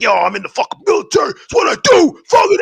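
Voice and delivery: imitated high, loud hype-man shouting; hyped. Ripped military guy announcing himself at the bar, hyped shouting. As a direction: build from excited to a high mock shout. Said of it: "he's just hyped".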